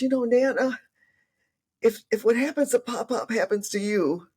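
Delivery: lightly voiced granddaughter asking Nana; little granddaughter. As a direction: ask it like a worried seven-year-old. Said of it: "she goes".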